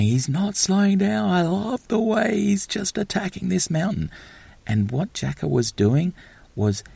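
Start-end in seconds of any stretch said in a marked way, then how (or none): 0.0-4.1 very high, sustained mock commentator cry; Striker's excited commentary. Imitating the commentator as the skater attacks the mountain, high and excited. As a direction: pitch it way up and hold it there, like a frantic race call. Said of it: "Striker knew what was going on".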